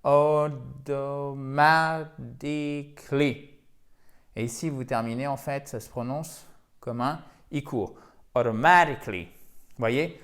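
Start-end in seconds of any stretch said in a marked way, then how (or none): 0.0-3.5 slow, stretched AUTOMATICALLY, syllable by syllable; découpé en syllabes. Shown as a demonstration. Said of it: "voyelle longue". 8.3-9.3 AUTOMATICALLY with MA punched louder; rythme accentué. Shown as a demonstration. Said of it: "Il y a vraiment un rythme".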